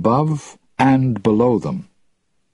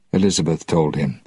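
ordinary delivery, nothing marked

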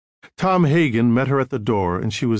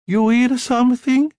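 high, loud Italian mama; heavy Italian accent; 12 semitones higher, 4 dB louder than usual. A narrator's reading. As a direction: push food with a thick Italian accent. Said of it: "She had a heavy Italian accent".

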